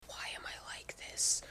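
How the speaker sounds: soft voiceless whisper; whispered despair at herself. Real, not acted. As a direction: ask yourself under your breath. Self-exasperated aside.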